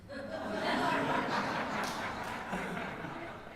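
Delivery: long soft airy laugh; real quiet laughter; breathy laugh. Real, not acted. Laughs after retort.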